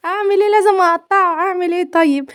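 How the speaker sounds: high-pitched, playful whine after a laugh; comic helplessness. Real, not acted. Cook lamenting to viewers that she must chop onions, comically helpless.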